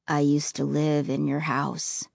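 low, quiet heavy voice; sorrowful ghost boy, low and soft. Acted.

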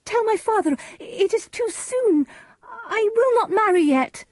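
very high, distressed protest; high-pitched, desperate objection; Juliet. A narrator's reading. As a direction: cry out your refusal in a high voice. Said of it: "cried Juliet".

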